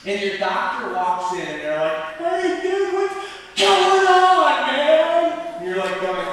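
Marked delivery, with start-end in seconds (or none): 2.2-5.3 high, loud dude greeting; loud, high-pitched party-dude voice; goofy dude surgeon. Acted. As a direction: greet the patient like an overexcited slacker.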